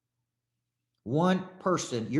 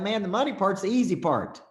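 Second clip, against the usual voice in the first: breezy dismissal; 6 semitones higher, 4 dB louder than usual. Retold or imitated. Tyler Perry brushing it off.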